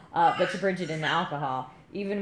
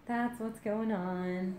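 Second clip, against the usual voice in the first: higher, slow, soft singsong; playful singsong; 4 semitones higher, 6 dB quieter, slower than usual. Pretend. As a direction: stretch it out lightly and quietly. Playful repeat of own line.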